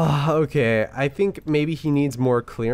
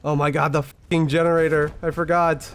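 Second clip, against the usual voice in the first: panicked; 4 semitones higher than usual. Real, not acted. Panic over the generator.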